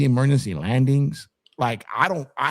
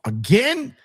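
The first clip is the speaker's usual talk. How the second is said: high rising disbelief; can't believe it's him; disbelieving; 12 semitones higher than usual. Retold or imitated. Spotting the marshal again, high disbelief. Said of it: "And I'm like".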